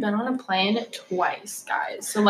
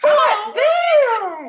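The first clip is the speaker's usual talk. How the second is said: much higher and louder, laughing shout; excited shout; 6 dB louder than usual. Real, not acted. Friends gasping at the actor's glow-up, excited shout. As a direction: scream at the glow-up photo. Friends reacting to hot photo.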